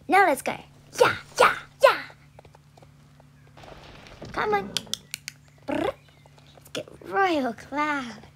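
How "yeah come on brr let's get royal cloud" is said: ordinary talk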